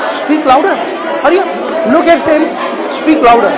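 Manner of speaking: loud, insistent call to the speaker; loud coaching call. Real, not acted. Teacher urging student at the front, loud calls. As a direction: call over the room to make her project. Coach shouting instructions mid-speech.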